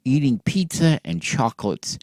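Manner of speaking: ordinary delivery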